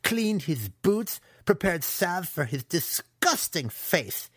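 high, slow, emphatic bitterness; aggrieved young villain's outburst; angry yelled rant. A narrator's reading. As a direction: spit out each grievance. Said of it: "Massage yelled".

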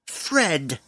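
dwarf correcting his nickname sharply; sharp retort. A narrator's reading. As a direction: snap back his proper name. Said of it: "the dwarf retorted sharply".

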